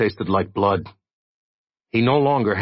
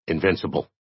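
ordinary delivery, nothing marked

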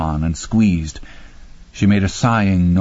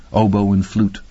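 ordinary delivery, nothing marked

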